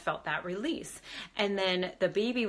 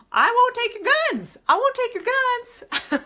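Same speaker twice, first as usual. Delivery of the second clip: much higher and louder, hammed-up promise; mock reassurance; 12 dB louder than usual. Promising Grandpa's spirit she won't take the guns, loud high mock vow. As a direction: squeal it out like a teasing vow.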